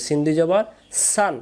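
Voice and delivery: সান with an open "aa"; Sin sound. Shown as a demonstration. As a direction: say সান thin and spread, as "saan". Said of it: "সান পড়লে সিনে চলে যাবে". (সান, সিনের মতো আকার দিয়ে)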